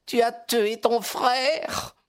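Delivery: high, slow lament; anguished exclamation. A narrator's reading. Mother reproaching her daughter over her brother, crying out. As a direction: accuse in a high, drawn-out cry. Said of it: "s'est écriée madame Charpentier".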